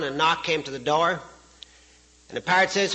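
ordinary talk

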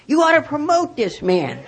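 high-pitched sarcastic outburst; hammed-up righteous indignation; mock indignant. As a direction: re-enact a worked-up rant at the manager. Retold rant to store manager.

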